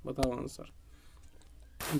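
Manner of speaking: slow, breathy whispered prompt. Acted. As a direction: whisper it slowly, coaxing an answer. Teacher prompts class for answer. (धीमी फुसफुसाहट में पूछना)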